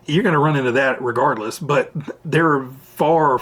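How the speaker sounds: normal talking voice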